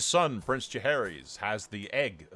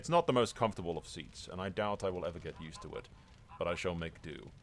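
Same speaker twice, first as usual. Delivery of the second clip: soft, measured reading of a coronation; solemn regal narration; 3 semitones lower, 4 dB quieter than usual. Acted. New king recounting his own coronation, solemn. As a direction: narrate it as the newly crowned king, hushed and stately.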